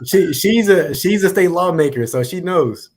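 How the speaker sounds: high, loud, teasing emphasis; playful teasing. Real, not acted. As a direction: tease her about being a lawmaker. Teasing the state lawmaker.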